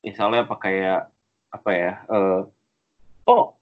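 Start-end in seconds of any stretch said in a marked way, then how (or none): 3.2-3.6 high-pitched imitation of online complaining; mock ranting poster. Imitating posters complaining about Anies, mock ranting. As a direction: rattle on in a high, griping mock voice.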